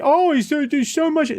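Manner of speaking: gushing high-pitched mock fan. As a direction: parody overexcited praise way up high. Mocking gushing fan praise.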